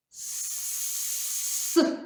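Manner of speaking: «С» as a long voiceless hiss. Shown as a demonstration. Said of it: "спробуємо сказати звук «с»". (тривалий глухий звук «С»)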